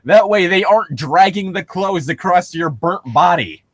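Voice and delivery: normal talking voice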